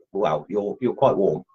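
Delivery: usual speaking voice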